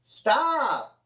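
high yelled Stop; mock fireman's high shout; retold holler. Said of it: "he'd holler".

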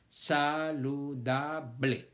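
saludable slowly split into sa-lu-da-ble; syllable by syllable. Shown as a demonstration. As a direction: spell saludable out in slow beats. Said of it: "la última es ble".

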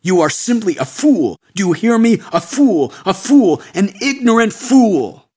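builds from scornful to high, loud yelling; ugly rising rant. Acted. Sadhu berating the American seeker, building angry yell. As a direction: hammer "fool" harder each time. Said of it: "his voice became ugly".

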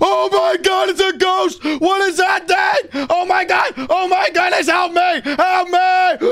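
high-pitched panicked cries; terrified screaming. Acted. Boy facing a ghost in the basement, terrified high screaming. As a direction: shriek for help in panic.

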